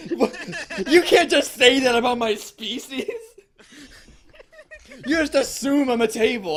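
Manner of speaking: laughing, high mock indignation; mock offended. Table customer protests to the waiter, high and laughing. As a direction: play an outraged table, laughing through it.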